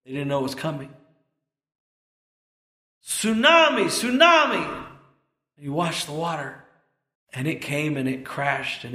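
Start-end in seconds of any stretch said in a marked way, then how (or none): 3.0-4.8 loud, high drawn-out warning cry; imitated shouting. Preacher as the man warning people below, shouting. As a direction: shout the alarm high, as the watching man did. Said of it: "he doesn't stop shouting".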